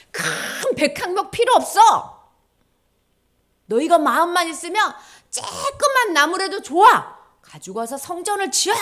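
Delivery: a voice from above, loud and aching; God's voice. Acted. God telling the people a small tree is enough, loud and tearful.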